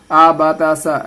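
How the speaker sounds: short syllables rattled off, आ बा ता सा; hurried wrong reading. Shown as a demonstration. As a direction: run the letters quickly together. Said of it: "इसको जल्दी जल्दी पढ़ना है". (जल्दी-जल्दी पढ़ना)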